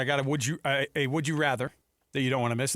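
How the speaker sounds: normal talking voice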